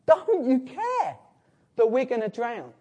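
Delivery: high, slow pleading cry of frightened men; panicked plea. Retold or imitated. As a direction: beg him to wake up and care. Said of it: "they say".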